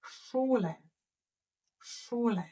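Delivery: Schule with sh and long u, lifted high; German Schule. Shown as a demonstration. Teacher answering how to say school in German, clear model word. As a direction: sing out Schule clearly twice. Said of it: "How do we say school in German".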